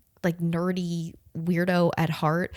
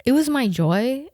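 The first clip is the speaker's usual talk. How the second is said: amused repeat; 6 semitones higher, 5 dB louder than usual. Shown as a demonstration. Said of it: "Chick-fil-A needs to start using that".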